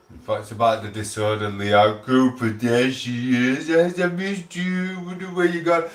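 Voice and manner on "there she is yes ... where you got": higher, slow affectionate hello; fond greeting. Real, not acted. Streamer greeting a missed viewer, fond and slow. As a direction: welcome her back sweetly, drawn out.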